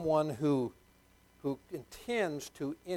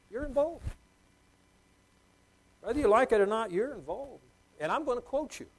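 mock confrontation; 8 semitones higher, 6 dB louder than usual. Confronting the gossiper, acted.

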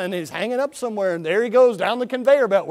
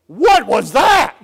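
slow, loud, high startled cry; the ox jumps in fright; 5 semitones higher, 8 dB louder, much slower than usual. Retold or imitated. Ox startled by shout.

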